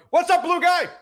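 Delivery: high loud heckle; yells out. Retold or imitated. Stranger heckling him at the stadium, loud mock yell. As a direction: call it out across the stadium. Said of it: "some guy yells out".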